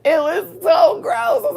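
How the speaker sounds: high, loud laughing through tears. Real, not acted. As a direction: laugh it out in disgust. Laughing crying over the smell.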